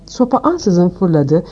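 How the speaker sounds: usual speaking voice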